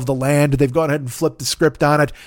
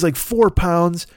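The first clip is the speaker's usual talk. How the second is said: normal talking voice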